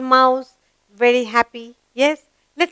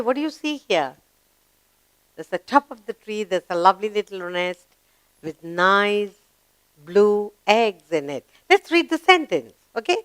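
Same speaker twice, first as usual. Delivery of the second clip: ordinary talk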